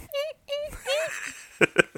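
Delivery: high Psycho stabbing screech; guinea pig squeak. Shown as a demonstration. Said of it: "Kinda sounds like an excited guinea pig".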